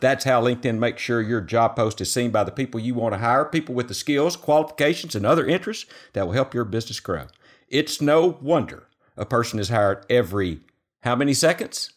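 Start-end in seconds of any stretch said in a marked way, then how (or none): 0.0-7.3 sponsor ad read in a steady pitch voice. Acted. Host reading the LinkedIn sponsor spot to listeners, ad read. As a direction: sell it like a podcast sponsor spot.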